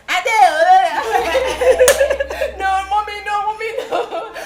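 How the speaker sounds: high, laughing mock panic. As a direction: squeal it out half laughing, half pleading. Panicked reaction to the date.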